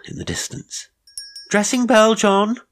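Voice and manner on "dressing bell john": high, loud household call; shout. A narrator's reading. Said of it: "shouted someone".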